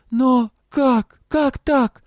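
high, loud, stammered disbelief; flustered. A narrator's reading. Girl reeling from father's confession, flustered. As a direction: blurt it out high, in broken bursts. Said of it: "говорила суетливо, запиналась".

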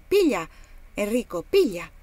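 put-on loud playful call to a child. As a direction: toss the words out loudly like the grapes. Master tosses grapes to boy. (richiamo forte e giocoso)

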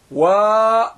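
"وا" with a long held aa; sustained long vowel on "وا"; fully lengthened vowel. Shown as a demonstration. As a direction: draw out the aa in "وا". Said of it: "وا بالإشباع".

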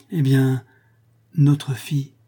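ordinary delivery

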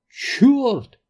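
very high, sharp outcry; cried-out oath. A narrator's reading. Паунд hears acid ate the tyres, crying out. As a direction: cry it out, upset. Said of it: "вскричал Паунд".

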